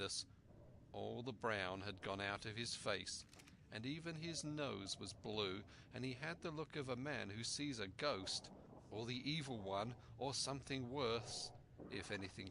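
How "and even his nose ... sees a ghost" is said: plain reading voice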